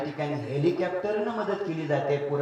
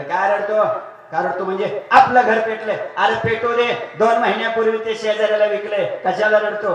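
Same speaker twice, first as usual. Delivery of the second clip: loud, high dismissive scolding; old man scolding; 5 semitones higher, 10 dB louder than usual. Acted. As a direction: brush off the son's tears loudly. Old man questions third son. (मुलाला मोठ्याने झिडकारणारा म्हातारा)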